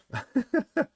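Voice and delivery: squeaky high laugh. Real, not acted. Laughs at own accent.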